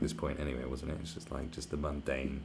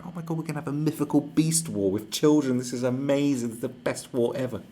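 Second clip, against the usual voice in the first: higher, louder giddy delight; mock thrilled; 7 semitones higher, 7 dB louder than usual. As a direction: rave about it like a giddy fan. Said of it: "They'll go".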